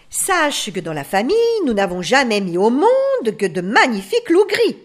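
loud, emphatic family pride; self-assured. A narrator's reading. Aunt wolf boasting of the family line, self-assured. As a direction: declare it with full confidence. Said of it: "d'un ton assuré".

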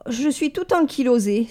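mild pampered moan; complaining. Acted. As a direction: grumble about being stiff. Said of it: "se plaignit-elle".

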